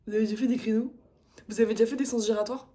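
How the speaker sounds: mocking parroted question in a put-on voice; mimicking the instructor. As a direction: parrot him with scorn.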